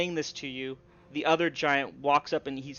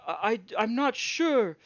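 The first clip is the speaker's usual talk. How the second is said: high, stammering giantess in shock; distraught giantess; 9 semitones higher, 4 dB louder than usual. Acted. Female giant asking the guards why her kin is dead, high and distraught. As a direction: stammer out her confusion and grief. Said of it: "and she goes".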